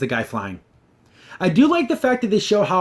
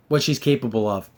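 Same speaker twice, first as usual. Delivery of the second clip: normal talking voice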